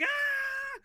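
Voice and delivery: high-pitched imitation of a terrified yell; mock panicked scream. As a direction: squeal like a panicking crowd. Everyone panicking in mosque.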